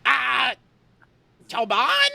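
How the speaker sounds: a hint of a squeaky mimic voice; lightly voiced rat mimicking Shit; squeaky bickering rat chorus. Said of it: "Rat Pack is telling ... mimicking Shit".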